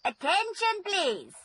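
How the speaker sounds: teacher raising her voice over the class; calling out. Real, not acted.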